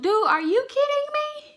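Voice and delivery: very high, loud disbelief; retold "are you serious" pitched sky-high; incredulous outburst. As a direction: squeal it in mock outrage. Acted disbelief at his lie.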